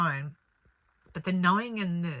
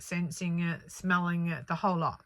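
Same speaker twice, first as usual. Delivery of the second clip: normal talking voice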